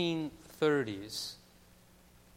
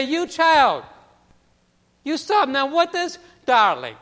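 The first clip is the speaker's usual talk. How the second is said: put-on high, loud old-world lady voice; an elderly immigrant woman calling to a child, high and loud; Mrs. Goldberg's accent; 10 semitones higher, 9 dB louder than usual. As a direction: sing out "darling" like a doting old neighbor. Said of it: "with that, that, that accent".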